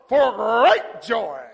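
very loud, slowly stretched shout; exultant proclamation. Acted. Paul promising joy to the troubled, loud exultant proclamation. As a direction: blast it out as Paul, drawing each word long. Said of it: "And he goes".

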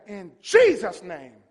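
slow, loud, emphatic declaration; loud shout. Shown as a demonstration. Preacher leading the congregation to shout, forceful. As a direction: punch out the name. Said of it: "Shout as loud as you can".

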